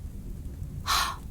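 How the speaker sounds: a shocked intake of breath; sharp gasp. Acted. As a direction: gasp in surprise. Surprised gasp at news.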